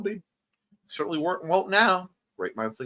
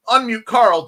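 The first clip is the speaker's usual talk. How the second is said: loud, raised unmute command; sudden loud, higher call; 6 semitones higher, 10 dB louder than usual. Pretend. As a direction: call it out high and loud like an order.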